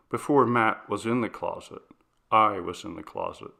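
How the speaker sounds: plain reading voice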